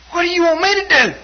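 high, loud mock exasperation; exasperated brother. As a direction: act out a fed-up demand.

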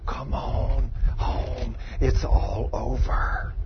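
slow, quiet, half-whispered beckoning; soft luring voice. Retold or imitated. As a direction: coax him gently to let go. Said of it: "One of those beautiful voices is saying".

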